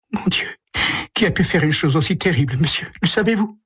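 high, fast pleading voice; a shaken mother begging for answers; distressed mother. Acted. Mrs Andrews asks the detective who killed her son, high and pleading.

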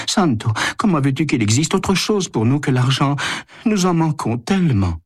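high-pitched woman's character voice; pleading wife. A narrator's reading. Wife explains to Santo how money is lacking, pleading.

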